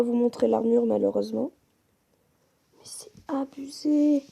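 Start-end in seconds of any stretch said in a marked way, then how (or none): 2.8-4.2 slow awed whisper. Real, not acted. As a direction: breathe it out slowly in awe. Amazed at the netherite recipes.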